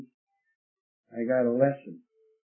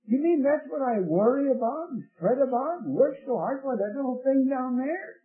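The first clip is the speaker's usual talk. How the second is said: high-pitched, disbelieving outburst; incredulous realization; 10 semitones higher than usual. Retold or imitated. Farmer realizing his big worry is tiny, incredulous. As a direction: question it in sustained amazement.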